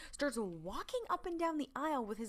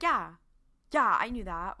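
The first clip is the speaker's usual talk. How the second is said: louder, defensive comeback; mock defensive; 7 dB louder than usual. Dean answering Sam's reminder about Christo, mock defensive. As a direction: brush off the correction with some force. Dean bristling at being corrected.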